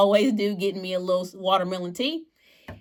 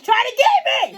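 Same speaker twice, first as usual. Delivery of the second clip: much higher, slow squeaky mock fear; mock panic squeak; 5 dB louder, slower than usual. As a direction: squeal it in pretend terror.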